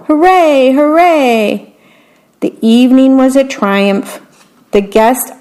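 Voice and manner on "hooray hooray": crowd cheer. A narrator's reading. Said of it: "the audience shouted".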